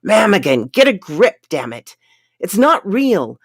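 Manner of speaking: loud, urgent shout to his friend. A narrator's reading. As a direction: snap him out of it with a shout.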